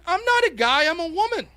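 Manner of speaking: high, loud protest of feigned offence; mock indignation. Debater claiming offence to opponent, mock indignant. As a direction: protest as if gravely insulted.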